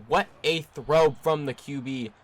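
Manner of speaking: slow and loud, hitting What; excited cheer. Real, not acted. Gamer cheering his quarterback's throw, loud and slow.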